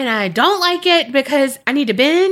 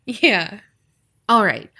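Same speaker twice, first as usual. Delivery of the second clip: usual speaking voice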